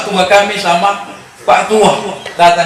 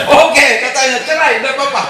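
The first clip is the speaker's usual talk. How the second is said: defiant father; 9 semitones higher than usual. Retold or imitated. Father accepts divorce, retold.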